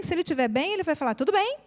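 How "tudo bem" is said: tudo bem asked with a normal rising tune; ordinary greeting tune. Retold or imitated. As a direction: say it the way anyone would. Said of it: "no mesmo entonação que a gente". (entonação normal de pergunta)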